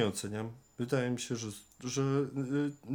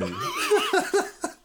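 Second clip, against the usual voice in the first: high giggling burst; burst of laughter; 5 dB louder than usual. Real, not acted. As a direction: laugh at your own joke. Laughs at own rhyme.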